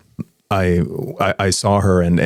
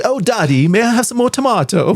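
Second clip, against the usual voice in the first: put-on much higher, sweet little English girl voice; posh little girl; 14 semitones higher than usual. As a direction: squeak it like a tiny posh girl. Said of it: "the way she was talking".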